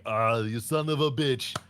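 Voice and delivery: a hint of Schwarzenegger's Predator greeting; lightly voiced action-hero grunt; quoting the Predator handshake scene in a mild Arnold voice; Arnold impression. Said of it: "Arnold Schwarzenegger, uh, Predator".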